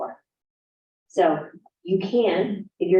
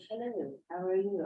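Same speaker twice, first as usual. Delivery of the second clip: mock video voice; 11 dB quieter than usual. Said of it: "when they get in the video".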